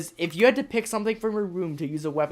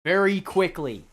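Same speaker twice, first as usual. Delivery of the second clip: slow emphatic; 5 semitones higher, 3 dB louder, slower than usual. Acted. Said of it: "mock request to be brief".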